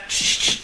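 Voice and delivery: unvoiced repeated shush; urgent shushing. Father hushing the visitor with the post, urgent shush. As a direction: shush them twice, urgently.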